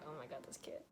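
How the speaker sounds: low, very soft aside; hushed, low-pitched retold reaction; muted aside. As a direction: say it under the breath. Retold aside about the kid.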